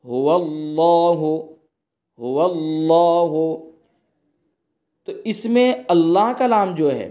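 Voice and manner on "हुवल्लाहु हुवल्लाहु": हुवल्लाहु slowly, with a full, heavy Allah; slow recitation demo. Shown as a demonstration. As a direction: draw out हुवल्लाहु full-mouthed. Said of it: "जैसे".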